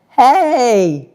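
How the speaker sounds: calling out. Retold or imitated. Friend calling from a block away.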